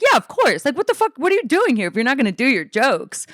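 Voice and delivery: high-pitched, fast exasperated friend; incredulous scolding. Retold or imitated. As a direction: imitate a pushy friend telling you off. Friend scolding her, acted out.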